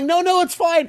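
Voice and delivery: high, hurried cover-up; mock-panicked insistence that all is well; flustered reassurance. Host covering a botched surprise to a locked-out friend, flustered.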